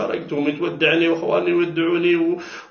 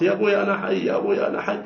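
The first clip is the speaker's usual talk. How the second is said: retold scream; 6 semitones higher, faster than usual. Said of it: "لما صرخت".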